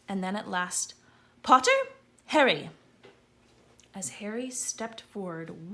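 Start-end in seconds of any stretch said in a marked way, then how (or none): 1.4-2.7 loud, high roll-call announcement; calling out names. A narrator's reading. Professor calling the next pupil loudly to the stool. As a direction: call each name across the hall.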